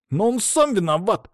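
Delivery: high-pitched, eager protest; butting in. A narrator's reading. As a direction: cut in with a high objection. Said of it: "встрял Саня". (встревает с возмущением, высоким голосом)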